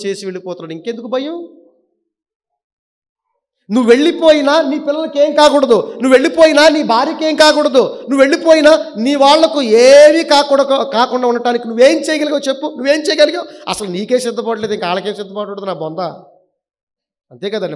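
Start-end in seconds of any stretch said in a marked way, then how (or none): 3.7-13.7 high, loud sermon delivery; forceful preaching. Real, not acted. Preacher warns married believers about their families, forceful preaching. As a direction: drive each repeated clause home from the pulpit.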